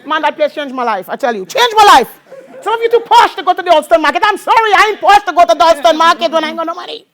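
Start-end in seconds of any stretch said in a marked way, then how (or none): 1.5-7.1 louder, higher preaching voice, pushing each line out; preaching at full force. Real, not acted. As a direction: preach it hard to the congregation. Congregation testimony, preaching.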